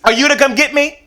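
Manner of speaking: high, loud pleading mama; high-pitched mom voice; mom's voice. Retold or imitated. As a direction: call out to her husband being led away. Mom asks dad from arrest.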